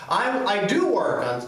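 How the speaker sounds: "do" lifted and stressed; emphasis on do. Shown as a demonstration. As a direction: insist on the word "do". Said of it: "you wanna make an emphasis".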